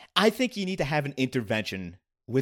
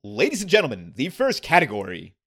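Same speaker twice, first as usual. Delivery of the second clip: announcer voice; 3 semitones higher, 4 dB louder than usual. Shown as a demonstration. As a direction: announce it to the crowd. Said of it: "I'll put on my announcer voice".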